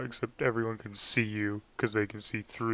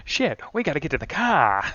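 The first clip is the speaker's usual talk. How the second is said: put-on old-movie gangster, a bit raised; thirties transatlantic voice; 4 semitones higher, 3 dB louder than usual. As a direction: hurry like a 1930s crook. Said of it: "Like thirties".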